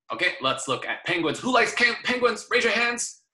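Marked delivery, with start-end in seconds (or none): 1.4-3.2 high, bright call to the class; teacher rallying young pupils, much higher; excited calling out. Acted. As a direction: call out to the kids to raise hands.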